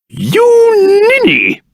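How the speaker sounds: high, drawn-out theatrical rebuke; exasperated scolding. Acted. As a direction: stretch the insult out high in exasperation. Scolding a companion.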